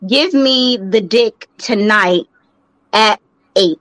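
slow, drawn-out mock note; slow mock letter. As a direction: read it slowly and deadpan. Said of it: "write you a letter and say".

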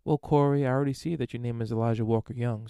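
normal talking voice

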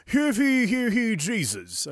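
high, loud town-crier call falling away at the end; hammed-up archaic proclamation; mock ancient disciple. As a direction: proclaim it like an old-time herald. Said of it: "how they said it two thousand years ago".

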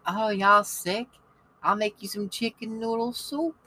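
put-on sweet Southern old lady. Ninety-four-year-old Lily offering her sick roommates soup, high Southern coo. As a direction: coo like a kindly Southern grandmother. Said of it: "You know, she's Southern".

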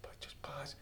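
breathy whisper of a calm instruction. Retold or imitated. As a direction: hush it almost to nothing. Miming the manager's calm.

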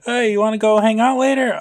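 high, quick, mock-chummy invitation; Billy. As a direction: play an oblivious buddy making plans. Joking as falsely friendly Billy.

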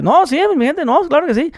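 high, hammed-up norteño accent; mock northern Mexican accent. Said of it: "por el acento como norteñito".

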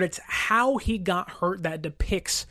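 ordinary talk

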